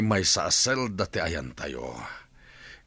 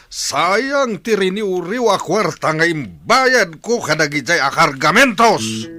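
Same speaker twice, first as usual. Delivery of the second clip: anguished rage; 8 semitones higher, 5 dB louder than usual. Acted. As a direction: wail angrily over the wasted money. Raging over money lost on cargo.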